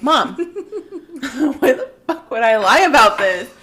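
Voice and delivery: mock outrage at not being believed; exasperated protest. Sister retelling protesting to her mom, laughing indignant yell. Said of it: "I'm like".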